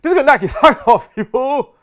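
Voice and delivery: loud, high, fast comic shout with a laugh; Bernie Mac impersonation. Retold or imitated. Speaker retrying the impression for the crowd, loud high laughing shout. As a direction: yell it like a hyped stand-up comedian. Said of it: "I don't know if that was a good Bernie Mac".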